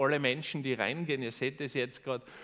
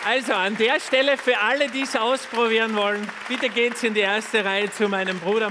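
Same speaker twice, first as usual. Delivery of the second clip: calling out; 6 semitones higher, 7 dB louder than usual. Real, not acted. Calling out to the audience.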